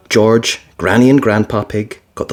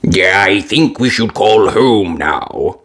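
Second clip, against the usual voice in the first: slow, lightly voiced grandpa; Grandpa Pig voice; 3 semitones lower, slower than usual. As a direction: suggest like a calm grandpa. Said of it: "said Grandpa Pig".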